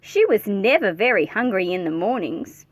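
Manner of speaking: plain reading voice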